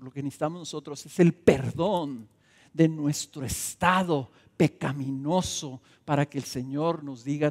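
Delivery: normal talking voice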